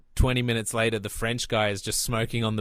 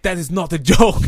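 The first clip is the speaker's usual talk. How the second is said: mock outrage, higher and louder; super indignant; 5 semitones higher, 6 dB louder than usual. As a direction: play the offended Frenchman getting in his face. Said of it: "the French guy gets super indignant".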